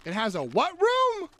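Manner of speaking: can't believe what you heard; surprised. Real, not acted. Reacting to surprising news.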